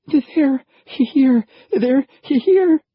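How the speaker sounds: panicked high yell; terrified. A narrator's reading. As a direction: scream the warning in terror. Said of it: "his eyes wide with terror".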